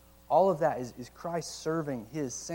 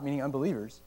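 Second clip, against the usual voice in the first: ordinary talk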